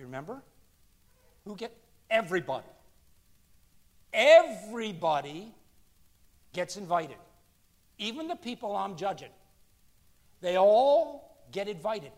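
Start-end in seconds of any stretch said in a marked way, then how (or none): 4.1-5.5 Everybody swelled out loud and slow, rising; emphatic. Real, not acted. Preacher telling the congregation everybody is invited, booming. 10.4-12.1 louder and slow, every word pressed; emphatic. Real, not acted. Preacher assuring the congregation all are invited, loud and slow.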